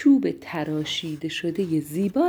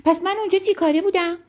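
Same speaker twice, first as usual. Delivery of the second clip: high, fast indignant outburst; offended storybook squirrel crying out; upset shout; 10 semitones higher, 4 dB louder, faster than usual. A narrator's reading. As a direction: protest loudly at being left out. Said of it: "خیلی ناراحت شده بود فریاد زد".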